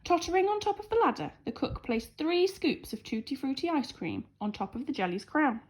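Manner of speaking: usual speaking voice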